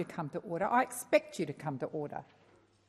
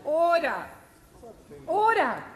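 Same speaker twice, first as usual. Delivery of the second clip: higher and louder, firm call; shouting order order; 9 semitones higher, 4 dB louder, slower than usual. Real, not acted. President calling chamber to order.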